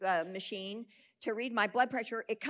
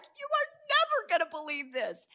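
high, thrilled retold phone call; excited news; 8 semitones higher, 4 dB louder than usual. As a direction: burst out with astonishing news. Acts out interviewer's excited phone call.

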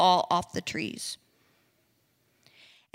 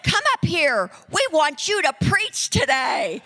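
calling out; 6 semitones higher than usual. Retold or imitated. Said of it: "So they said".

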